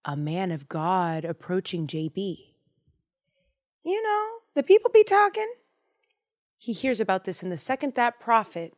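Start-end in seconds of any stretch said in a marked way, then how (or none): 3.8-5.5 very high sing-out voice; gossipy aside. Acted. Host explains how news reached the prophet, gossipy high voice. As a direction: pitch it way up like passing gossip.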